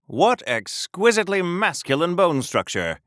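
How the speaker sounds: ordinary delivery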